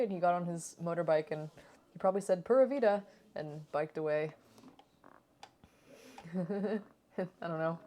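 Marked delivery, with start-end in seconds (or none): 2.4-3.0 put-on Pura vida in a thick American accent, "Pura" with a hard American R; strong American accent. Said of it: "with such a strong American accent".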